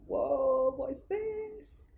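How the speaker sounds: high, wide-eyed astonishment; amazed whoa. Retold or imitated. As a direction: gasp it out in disbelief. Amazed arrival at Mumbai airport.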